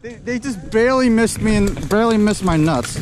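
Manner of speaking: excited. Real, not acted. Relieved near miss.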